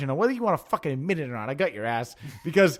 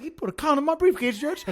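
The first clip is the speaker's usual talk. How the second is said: whiny tattle; 9 semitones higher than usual. Acted. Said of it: "I was like".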